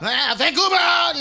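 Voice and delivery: high, stretched-out holler; screamed out loud. Shown as a demonstration. Comedian tests Vancouver as a city to scream, long high shout. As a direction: yell the city name slowly as a crowd cry. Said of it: "favorite to scream out loud".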